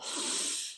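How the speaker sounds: a long, breathy morning sigh; tired sigh. Retold or imitated. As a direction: sigh like waking up with no energy. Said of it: "I wake up and I'm like".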